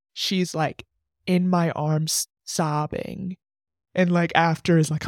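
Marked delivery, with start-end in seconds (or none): none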